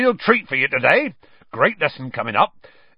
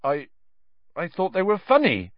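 uncertain; 9 semitones higher than usual. A narrator's reading. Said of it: "Hagrid said uncertainly".

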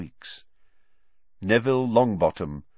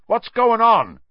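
high, loud startled question; alarmed call; 11 semitones higher, 9 dB louder, slower than usual. Acted. Seamus calls from the other side of the room, alarmed shout. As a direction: call out across the room, much louder.

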